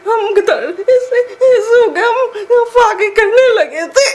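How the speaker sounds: real sobbing, high and loud; crying. Real, not acted. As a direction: wail the words out slowly through tears. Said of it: "पहले रोना तो बंद करें ना".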